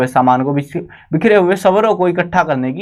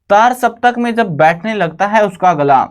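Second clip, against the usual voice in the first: poem recitation; 5 semitones higher than usual. Shown as a demonstration. Teacher reads the poem line aloud to students, reciting. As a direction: recite "तार सप्तक में जब बैठने लगता है उसका गला" higher, as a poem line. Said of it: "अब यहाँ पे बोल रहे हैं तार सप्तक".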